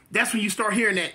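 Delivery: normal talking voice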